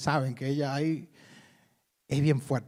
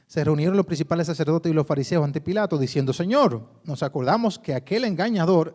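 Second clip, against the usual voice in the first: lectura proclamada, más fuerte; lectura bíblica; 2 semitones higher, 4 dB louder than usual. Acted. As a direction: leer la Escritura a la congregación. Reads scripture aloud. (proclamando el texto ante la iglesia)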